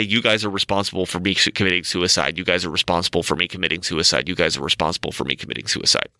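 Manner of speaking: low, monotone droning repetition; intercom announcement. Retold or imitated. School over the intercom blaming protesting kids, low monotone announcement. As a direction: drone it out like a looped announcement. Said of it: "come over the intercom and say".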